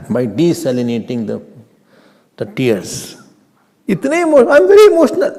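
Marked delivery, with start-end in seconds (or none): 3.9-5.3 high, loud mock whine; teacher mimicking someone insisting they are emotional; mock emotional claim. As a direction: overplay the touchy student. Imitating emotional person.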